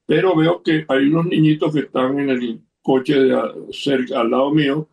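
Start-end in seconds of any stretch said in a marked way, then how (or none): none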